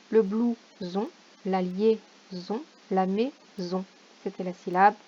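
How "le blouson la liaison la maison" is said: slow, spaced out syllables; slow syllable reading. Shown as a demonstration. Teacher to children at home, slow syllable reading. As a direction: read like a dictation. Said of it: "reads word list slowly".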